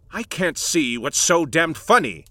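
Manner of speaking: snapped. A narrator's reading. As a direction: snap it back, stung. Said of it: "I snapped".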